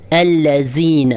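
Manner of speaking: الذین with a hissing, hard z in place of dh; hard whistling z. Shown as a demonstration. Teacher demonstrating the wrong hard z sound. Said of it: "سیٹی کی آواز ... سختی سے".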